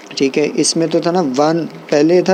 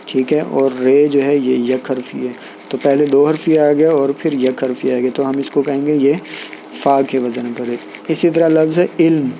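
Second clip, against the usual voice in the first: ordinary talk, nothing marked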